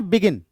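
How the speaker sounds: "begin" with light be, stressed falling gin. Shown as a demonstration. Teacher tests the word for students, weight on gin in "begin". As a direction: lean on the second syllable of "begin". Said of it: "बी पे unstressed होता है".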